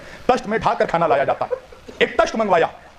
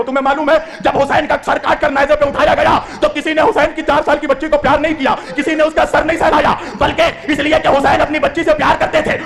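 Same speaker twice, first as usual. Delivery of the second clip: high, loud wailing sermon; full-force lament; impassioned preaching; 7 semitones higher, 4 dB louder than usual. Acted. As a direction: cry it out to the mourners.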